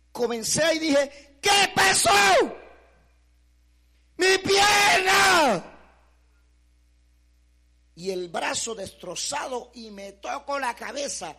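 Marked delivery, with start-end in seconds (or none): 1.4-5.7 high, loud shout of horror, pierna drawn out slowly; anguished cry. Retold or imitated. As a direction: cry out in shock at the missing leg. Discovers his leg is gone. (grito angustiado, alto y fuerte)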